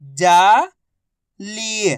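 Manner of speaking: जाली split as जा, long pause, ली; syllable by syllable. Shown as a demonstration. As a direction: sound out जाली slowly, जा rising, ली falling. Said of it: "एक अक्षर की पहचान करो, उनका उच्चारण करो". (जाली को अक्षर-अक्षर अलग बोलें)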